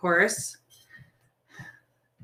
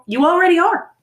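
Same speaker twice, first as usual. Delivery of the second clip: emphatic; 9 semitones higher, 7 dB louder than usual. Real, not acted. Insisting to the listener.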